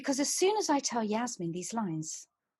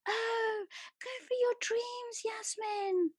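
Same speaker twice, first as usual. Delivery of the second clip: mocking quote; 12 semitones higher, 4 dB quieter, slower than usual. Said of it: "everyone saying".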